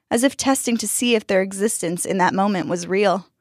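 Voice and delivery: plain reading voice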